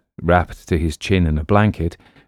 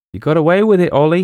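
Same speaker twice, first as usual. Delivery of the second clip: much higher, louder, anxious voice; worried sidekick; 11 semitones higher, 6 dB louder, faster than usual. Acted. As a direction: fret to your friend. Boston worried after manager leaves.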